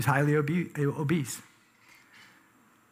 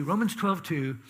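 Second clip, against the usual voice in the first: ordinary delivery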